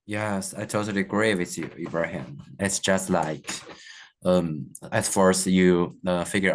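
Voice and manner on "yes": ordinary talk, nothing marked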